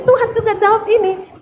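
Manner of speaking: normal talking voice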